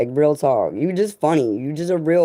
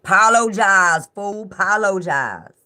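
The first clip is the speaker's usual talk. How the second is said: drawn-out, higher-pitched scolding; hammed-up slow demand for an apology; mock shouting out; 4 semitones higher, much slower than usual. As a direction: call him out, stretching each demand. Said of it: "we will shout him out and be like".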